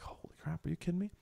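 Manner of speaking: retold stunned near-whisper, very soft; hushed disbelief. Retelling his reaction to being offered a host family, hushed disbelief. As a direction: drop to a breathy, amazed hush.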